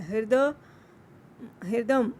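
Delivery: हृदय and हृदम with a clear ri after h; teacher repeating हृदम; pronunciation demo. Shown as a demonstration. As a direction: repeat हृदम crisply for students. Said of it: "ह क पैर में र है".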